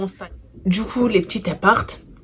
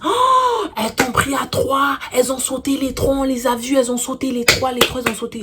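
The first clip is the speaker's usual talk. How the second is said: put-on shocked little girls; 6 semitones higher, 4 dB louder than usual. Elles étaient choquées.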